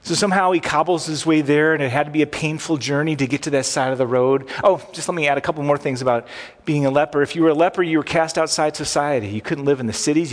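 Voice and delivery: normal talking voice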